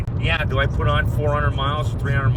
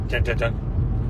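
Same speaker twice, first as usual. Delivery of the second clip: suspense sting; 4 semitones lower, 3 dB quieter, much slower than usual. Shown as a demonstration. Suspense in a story.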